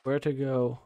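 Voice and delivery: usual speaking voice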